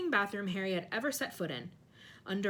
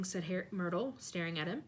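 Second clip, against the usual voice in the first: usual speaking voice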